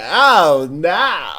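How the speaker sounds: loud, stretched mock dismay; exaggerated mimicry of a catchphrase; overreacting friend. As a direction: wobble out an imitated "oh, no". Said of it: "like, wiggle his face".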